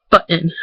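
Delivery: "button" as BUH'-in; glottal stop. Shown as a demonstration. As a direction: catch the voice in place of the tt in button. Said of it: "you kinda stop your voice".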